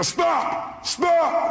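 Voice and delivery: high-pitched shouted command; retold yelling. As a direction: yell it to cut the caller off. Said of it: "I started yelling on the phone".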